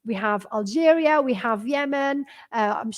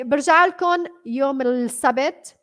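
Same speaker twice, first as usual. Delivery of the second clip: ordinary talk, nothing marked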